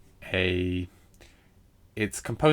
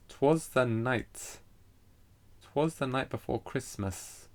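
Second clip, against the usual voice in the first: slow, higher, softer beat stretching "night" and "Christmas"; deliberate demonstration of long syllables in "'Twas the night before Christmas"; exaggerated metrical stress; 4 semitones higher, 5 dB quieter, slower than usual. Shown as a demonstration. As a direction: spell out the rhythm, lingering on "night". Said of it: "Christmas is the long syllable".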